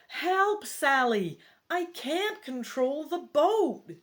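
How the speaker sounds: high, loud yell for help; panicked yell. Acted. Hero to Sally in the runaway boat, yelling. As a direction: cry out loudly, pitch jumping up. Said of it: "you yell".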